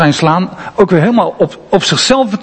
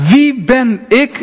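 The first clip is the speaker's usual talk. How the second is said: onzeker; 6 semitones higher, slower than usual. Retold or imitated. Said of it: "Onzeker, onzeker".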